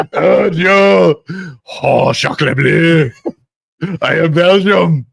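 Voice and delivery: put-on slow, hammy French-style Belgian accent; attempted Belgian accent. Host clowning as a Belgian, mock French-Belgian accent. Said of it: "Belgian accent".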